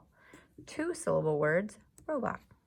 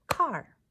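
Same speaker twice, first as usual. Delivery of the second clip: "Car" as one loud, higher beat; clapped-out syllable; 4 semitones higher, 6 dB louder than usual. Shown as a demonstration. Teacher clapping out car with pupils, "Car" in one loud beat. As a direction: punch "Car" out on a single clap. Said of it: "Let's clap out car".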